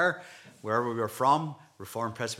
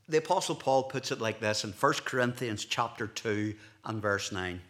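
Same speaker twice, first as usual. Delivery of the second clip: ordinary delivery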